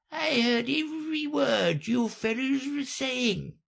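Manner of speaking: very high, weak croaky creature voice; hoarse, feeble. A narrator's reading. The Dormouse insists it was listening, hoarse and feeble. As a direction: insist feebly through sleepiness. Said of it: "in a hoarse, feeble voice".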